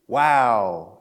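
low, drawn-out wow of wonder; awestruck wow. Acted. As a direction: step back and marvel. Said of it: "stepping back and going".

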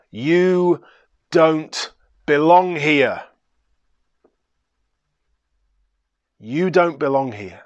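loud and slow, a firm verdict; strong commanding voice. Acted. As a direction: declare it so the whole room hears. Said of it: "said in a strong, commanding voice".

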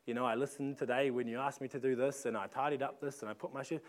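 lightly voiced little girl listing good deeds; quiet, hushed retelling of a child's thoughts; child's inner voice. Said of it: "and she's thinking".

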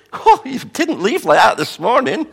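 high, loud, put-on fretting parent voice; flustered parent. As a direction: play the nervous mother. Parent voiced in Bible retelling.

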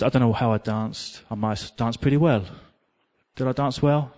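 ordinary delivery

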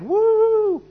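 loud, high, drawn-out whoop; high celebratory "woo"; crowd cheer. Acted. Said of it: "And the crowd cheered".

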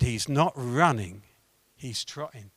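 low, hushed voice in the head; soft and low, like a private instruction; inner voice. Retold or imitated. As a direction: quietly voice an insistent inner prompting. Voice in his head speaks.

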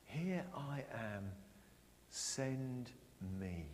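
soft, slow and hushed; quiet. Shown as a demonstration. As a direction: say it softly to yourself, slowly. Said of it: "You all said it".

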